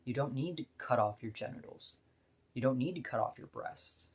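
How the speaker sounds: ordinary talk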